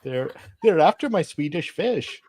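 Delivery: put-on high leprechaun-style Irish accent; lilting, badly done Irish leprechaun accent; high-pitched mock-Irish leprechaun lilt; [name]. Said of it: "He's not a leprechaun".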